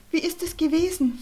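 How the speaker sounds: a worried mum's anxious question; mother worried about her son's first day. A narrator's reading. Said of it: "fragte seine Mutter besorgt".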